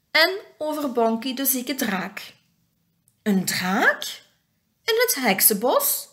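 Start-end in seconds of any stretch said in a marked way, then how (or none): 3.2-6.1 two rising, astonished questions; acted storybook astonishment; surprised. A narrator's reading. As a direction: react in disbelief, pitch swooping up. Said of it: "vraagt Elfje Fien verbaasd".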